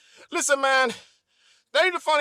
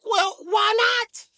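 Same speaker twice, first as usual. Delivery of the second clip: drawn-out why not; 6 semitones higher, much slower than usual. Retold or imitated. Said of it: "it's like".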